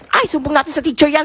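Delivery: usual speaking voice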